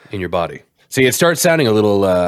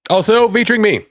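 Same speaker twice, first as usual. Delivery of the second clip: show announcer; 10 semitones higher, 3 dB louder than usual. Acted. Host announcing the podcast to listeners, high and hyped. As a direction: call it out high and big like a ring announcer.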